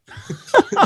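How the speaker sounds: high burst of real laughter; big real laugh. Real, not acted. Laughing at his own bathroom punchline. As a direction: cracks up at his own story.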